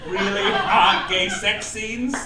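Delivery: ordinary talk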